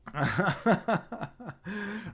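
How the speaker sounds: long, helpless chuckle; laugh. Real, not acted. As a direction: laugh it out at your own trouble. Laughing at own camera trouble.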